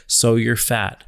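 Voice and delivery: blunt retold quote. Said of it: "I said".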